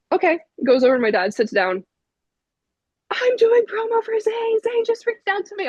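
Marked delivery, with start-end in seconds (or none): 3.1-5.7 very high squealed announcement; retold freak-out. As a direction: squeal the big news, reenacting fangirl hysteria. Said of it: "I'm freaking out".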